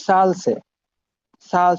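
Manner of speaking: lisped s in সাল, tongue between the teeth; Virus's lisp. Retold or imitated. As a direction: show the professor's lisp on সাল. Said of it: "তার এই উচ্চারণটা difficulties হয়". (সাল-এ জিভ বের করা নরম স)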